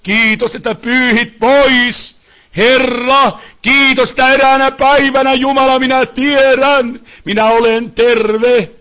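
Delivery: fervent prayer of faith; declaring prayer. Real, not acted. Preacher thanks God for coming healing, fervent declaring prayer. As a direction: proclaim it to God with firm conviction.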